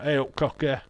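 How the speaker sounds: lightly put-on broad Yorkshire accent. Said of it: "Broad Yorkshire accent".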